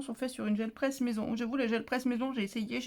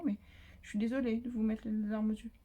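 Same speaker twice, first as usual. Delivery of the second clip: ordinary delivery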